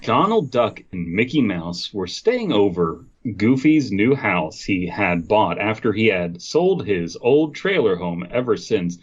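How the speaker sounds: ordinary talk, nothing marked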